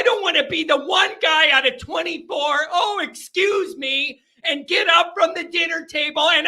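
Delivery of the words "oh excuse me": slow, prim little excuse me; mock polite excuse. Guest excusing himself from the packed dinner table, prim. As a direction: play the overly polite guest slipping away.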